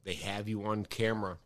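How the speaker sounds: lightly voiced friend laying out proof; accusing Jeff. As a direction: friend on phone, mild mock accusation. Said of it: "He goes".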